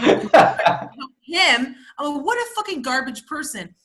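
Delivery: high scornful sneer at him; mock disgust. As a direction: sneer it out, playing his attitude. Mocking the man with attitude.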